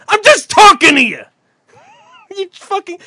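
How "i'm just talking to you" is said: high, loud mock protest; yelling. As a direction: yell back as if this were normal talking. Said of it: "Why are you yelling?".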